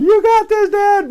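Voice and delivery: put-on high, far-off cheer of children; kids cheering. Children cheering dad on the tower, high cheer. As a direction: shout encouragement up to dad. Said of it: "cheering me on".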